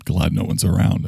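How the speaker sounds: ordinary talk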